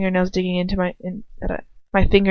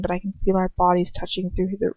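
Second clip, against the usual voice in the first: ordinary delivery, nothing marked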